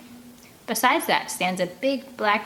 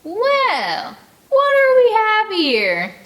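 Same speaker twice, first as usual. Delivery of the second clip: much higher, louder, slow sneering voice; menacing woman; 14 semitones higher, 9 dB louder, much slower than usual. Acted. Huge backwoods woman catching the kids, sneering. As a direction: loom over them and savour it.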